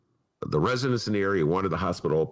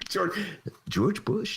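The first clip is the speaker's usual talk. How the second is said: a slightly higher, clucky take on George Bush; mock chicken impression, a touch higher; 10 semitones higher, slower than usual. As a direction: squawk it out like a chicken doing Bush. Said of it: "a great impression of George Bush as a chicken".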